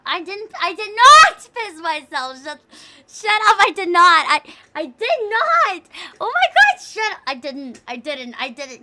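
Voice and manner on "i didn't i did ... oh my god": loud, high flustered protest; high, loud embarrassed denial. Real, not acted. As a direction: shout down the accusation. Chat accuses her.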